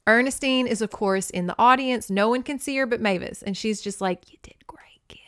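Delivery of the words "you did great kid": unvoiced whisper. Ghost mom praising Mavis, whispered. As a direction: hush it from the back row.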